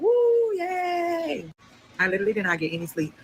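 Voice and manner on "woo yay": high, drawn-out whoop; crowd cheer. Retold or imitated. Inmates clap for her last count, high drawn-out cheer. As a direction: cheer long and high in celebration.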